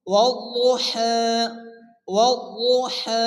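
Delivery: ওয়াল্লাহা slowly, double consonant and long final "haa"; slow, measured ওয়াল্লাহা, last vowel held; tajweed model reading. Shown as a demonstration. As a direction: model ওয়াল্লাহা for pupils with a drawn-out ending. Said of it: "তাহলে আমরা কি পড়ব?".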